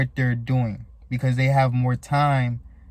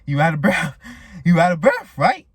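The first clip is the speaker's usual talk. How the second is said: mock panting winded runner, rising and louder; mock out of breath; 4 semitones higher, 5 dB louder than usual. To the fast rabbit viewer, mock out of breath.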